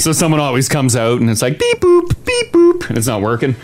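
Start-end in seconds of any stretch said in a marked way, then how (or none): 1.6-2.9 high robotic beep-boop imitation; machine beeps. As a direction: chirp like a broken pay machine. Imitating broken pay machine.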